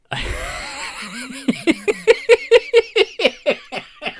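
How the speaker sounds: long, high shrieking laugh; real high-pitched laughing fit; helpless laughter. Real, not acted. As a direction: laugh until out of control. Still overcome by laughter.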